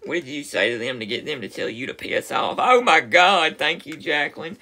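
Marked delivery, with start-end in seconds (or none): 2.6-4.6 loud, high burst of delight; excited outburst. Real, not acted. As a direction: blurt it out loud. Reacting to chat news.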